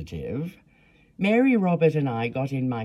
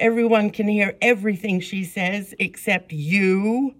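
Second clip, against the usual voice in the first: higher, louder indignant protest; angry; 8 semitones higher, 4 dB louder than usual. A narrator's reading. Narrator scolds the clerk for the girl, high, loud, indignant. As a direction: scold her for this cruelty. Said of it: "I became angry".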